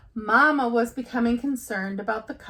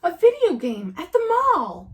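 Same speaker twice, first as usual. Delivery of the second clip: higher, louder angry shout from Papa Bear; 8 semitones higher, 7 dB louder than usual. Acted. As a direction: roar it out in disbelief. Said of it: "Papa shouted".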